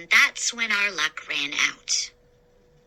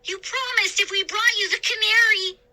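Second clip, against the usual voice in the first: upset cry; 14 semitones higher, faster than usual. A narrator's reading. Younger brother pleading the pirate keep his word, high and insistent. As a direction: insist through betrayal. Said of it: "Sean cried".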